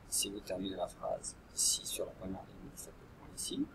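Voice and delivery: soft unvoiced whisper; whispered instruction. Real, not acted. Teacher whispering where to put the period, hushed. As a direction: hush the tip to the class.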